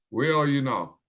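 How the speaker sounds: ordinary delivery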